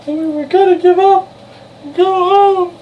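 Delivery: very high, loud mock wail; high squeaky wail. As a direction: squeal it like a cartoon.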